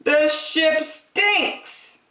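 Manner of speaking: high, slow whiny complaint; complaining passengers. A narrator's reading. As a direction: whine it slowly like a fed-up passenger. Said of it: "they said".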